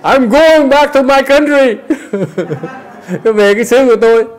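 high loud hammed-up escape, laughing; mock fleeing spouse. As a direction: play the spouse bolting home.

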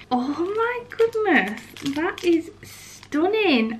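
high, slow, drawn-out awe; amazed gush. Real, not acted. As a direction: stretch it out, overwhelmed by how pretty it is. Unboxing a gorgeous gift.

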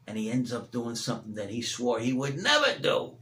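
usual speaking voice

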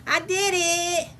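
high, drawn-out cheer; slow, high-pitched little victory call; proud celebration. Real, not acted. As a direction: stretch the words out triumphantly. Celebrating her first finished blanket.

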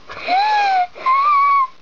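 high-pitched string of play screams; pretend scream. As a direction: squeal as if getting a shot. Patient reacts to getting a shot.

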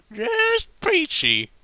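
very high, loud and drawn out; drunk Applejack. A narrator's reading. Tipsy Applejack mocking Rarity, very high and slow. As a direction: drag it out tipsily. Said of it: "responded with great effort".

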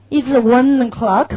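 slow "It's one o'clock" with words run together; linked reading. Shown as a demonstration. Teacher modelling linking in "It's one o'clock". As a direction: link "one" into "o'clock", slowly. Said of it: "连读啊".

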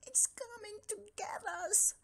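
high frightened whisper; scared whisper. Acted. Frightened cat whispers to his friend, high. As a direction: breathe it out in terror.

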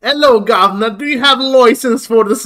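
hammed-up cockney British "Hello, governor"; loud, high parody British accent; mocking a dark elf with a British accent. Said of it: "the Dunmer speech was just like".

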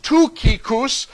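Toukikus said the Greek way, too-KEE-koos; Greek pronunciation. Shown as a demonstration. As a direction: open Toukikus with an oo sound, stress on KEE. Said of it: "in the Greek, his name is pronounced".

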